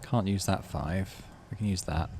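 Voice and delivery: normal talking voice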